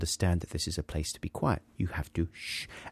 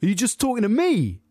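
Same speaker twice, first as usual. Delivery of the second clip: DJ guy; 12 semitones higher, 4 dB louder than usual. Retold or imitated. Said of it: "look over at me and go".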